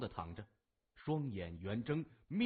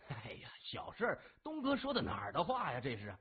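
quick, swooping, obliging reply; fawning policeman. A narrator's reading. Policeman obliging Brother Dong over money, fawning and quick. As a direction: brush it off eagerly to please the boss.

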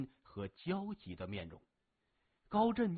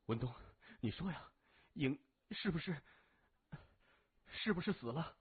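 anxious demand; 3 semitones higher, 4 dB quieter than usual. A narrator's reading. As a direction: press for the answer, dreading it. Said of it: "他大声说". (焦急地追问)

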